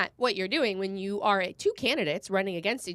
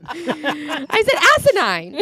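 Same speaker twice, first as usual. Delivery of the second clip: asinine dragged out slow and loud, higher; stressed asinine; 6 semitones higher, 7 dB louder, much slower than usual. Real, not acted. Host laughing, defending saying asinine to cohost. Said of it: "I didn't emphasize anything".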